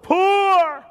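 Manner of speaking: high, loud, ringing cry on one word; preacher's emphatic shout. Real, not acted. Preacher answering his own question from scripture, emphatic shout. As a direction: belt out the key word of the verse. Climax of quoted verse, drawn out.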